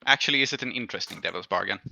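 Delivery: ordinary talk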